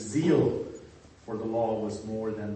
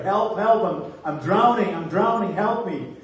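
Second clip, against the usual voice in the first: high, loud desperate shout for help; frantic cry for help; 6 semitones higher, 7 dB louder than usual. Acted. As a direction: yell like you're drowning. Drowning swimmer cries to lifeguard.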